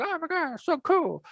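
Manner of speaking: high-pitched mock fan gushing. Imitating readers thrilled by the new book, gushing. Retelling people's reaction to book.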